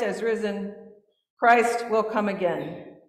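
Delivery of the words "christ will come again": ordinary talk, nothing marked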